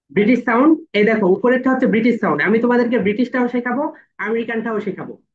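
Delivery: loud, fast over the recording; teacher shouting over the played audio; loud announcing. Real, not acted. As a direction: announce it loudly to the room.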